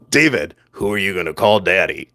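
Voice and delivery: low, chesty mock villain; deep voice, barely keeping a straight face; mock-menacing low dad voice; Darth Vader. Shown as a demonstration. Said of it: "couldn't even do it with a straight face".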